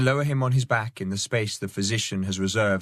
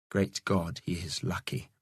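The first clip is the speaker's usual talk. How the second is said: ordinary delivery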